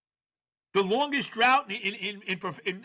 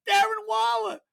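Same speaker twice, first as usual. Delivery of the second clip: incredulous repeat; 3 dB louder than usual. Real, not acted. Repeats name in higher disbelief.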